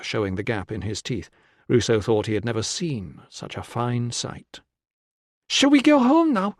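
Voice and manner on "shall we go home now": put-on high, loud child's voice; young boy. A narrator's reading. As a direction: ask as an eager little boy. Boy asks to go home.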